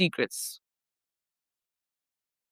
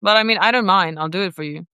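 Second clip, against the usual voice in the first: usual speaking voice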